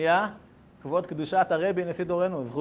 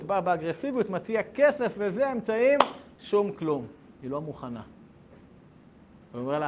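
normal talking voice

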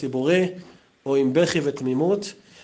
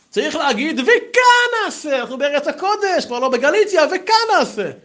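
emphatic ruling; 12 semitones higher, 5 dB louder than usual. Retold or imitated. Rabbi telling the students the correct prayer wording, emphatic. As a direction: hammer home וכאן נעשה as settled law.

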